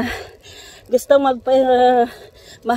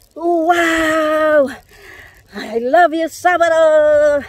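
excited cheer; 8 semitones higher, 10 dB louder, much slower than usual. Real, not acted. Cheering while cycling.

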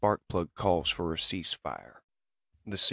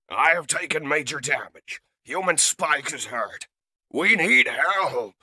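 urgent distress call; 10 semitones higher, 7 dB louder than usual. Acted. Damaged leader radios for help.

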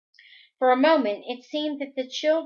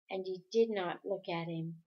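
plain reading voice

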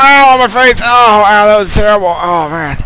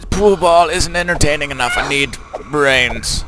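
hammed-up undead complaint; zombie; 5 semitones higher than usual. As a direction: groan hungrily like a zombie. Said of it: "He's like".

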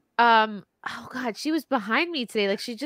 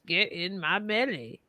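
put-on Scottish accent; 4 semitones lower, 6 dB quieter, slower than usual. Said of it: "how to learn Scottish".

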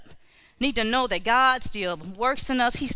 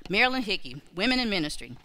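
usual speaking voice

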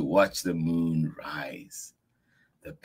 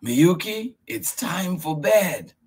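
high, louder bedtime call; grandfather calling; 12 semitones higher, 4 dB louder than usual. Acted. Grandfather telling Miyuki it's bedtime. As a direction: call her in for bed.

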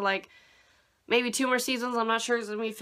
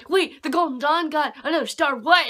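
loud, higher outraged voice; mock angry Asta; 7 semitones higher, 5 dB louder than usual. Reviewer imitating Asta furious that Golden Dawn got another star, loud. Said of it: "super angry".

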